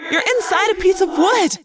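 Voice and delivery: high, frantic thought-voice; screamed warning. A narrator's reading. Friend warns Cassie inside the wood, screaming. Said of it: "a voice screamed in my head".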